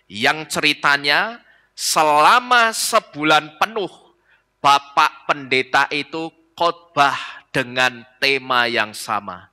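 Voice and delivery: ordinary talk, nothing marked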